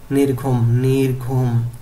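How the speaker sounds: নির্ঘুম modelled as nir-ghum, then stretched slowly; pronunciation drill. Shown as a demonstration. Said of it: "উচ্চারণ করতে হবে এইভাবে".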